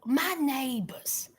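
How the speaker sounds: slow hushed whisper; hushed aside about the neighbors, slow whisper. Real, not acted. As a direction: whisper it so the neighbors won't hear.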